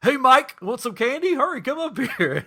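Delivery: loud, high, friendly holler; calling out. Retold or imitated. Homeowner hailing a masked kid lurking in the yard, cheerful shout. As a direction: call him up from across the yard.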